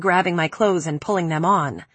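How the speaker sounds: ordinary delivery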